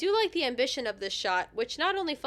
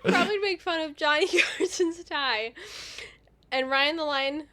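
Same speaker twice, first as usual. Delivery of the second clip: laughing; 6 semitones higher than usual. Real, not acted. Cracking up mid-read.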